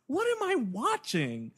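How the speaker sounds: high, incredulous squeak; mock bafflement. Viewer reacting to an absurd fight scene, high mock bafflement. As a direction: ask it high-pitched, in comic disbelief.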